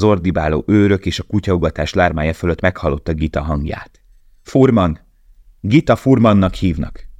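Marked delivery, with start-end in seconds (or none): 4.4-7.1 crying out across the din; calling out. A narrator's reading. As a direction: shout her name so he hears. Gita calls over guards, dogs.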